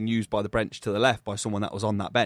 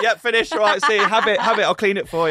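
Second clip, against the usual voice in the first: mock compliance; 8 semitones higher, 7 dB louder than usual. Mock backing down to big man.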